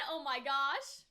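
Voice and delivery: ordinary talk, nothing marked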